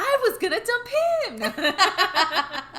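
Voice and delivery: high, loud and drawn out, laughing; hammed-up protest breaking into laughter; mock outburst. Riffing as the woman in the video, hammed-up laughing outburst.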